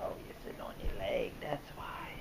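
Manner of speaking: unvoiced, fast whispered aside; dad notices his son's leg and whispers quickly. Real, not acted. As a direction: breathe it out fast under the breath. Aside while baby sits.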